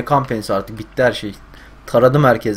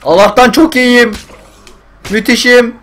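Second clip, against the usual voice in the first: loud, high ironic boast; sarcastic; 11 semitones higher, 9 dB louder than usual. Real, not acted. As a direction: scream that you're fine while dying. Hurt badly, says he is great. (alaycı, yüksek sesle)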